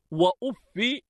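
أف ending in a short "i", "uffi"; kasra on fa. Shown as a demonstration. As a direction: say وأف as "wa-uf-fi". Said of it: "بكسر الفاء".

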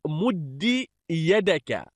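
مد said "muddi", short i after doubled d; kasra ending. Shown as a demonstration. Teacher giving students a parallel verb, مد as "muddi". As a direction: end مد on "-di". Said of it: "جعله بمنزلة".

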